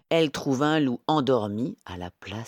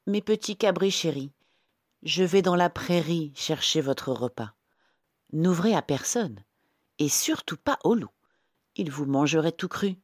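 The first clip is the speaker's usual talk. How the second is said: mother goat; 6 semitones higher than usual. Acted. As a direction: gently warn the little kids. (maman chèvre, légèrement jouée)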